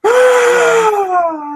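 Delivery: loud high shocked gasp. Real, not acted. Shocked at Ben Affleck news.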